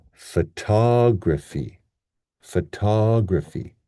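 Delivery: "photography" slow, as fuh-TOG-ruh-fee; long strong TOG in "photography", schwas around it; stress on second syllable. Shown as a demonstration. Teacher modelling the word, slow TOG-stressed "photography". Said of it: "the stress here is on the".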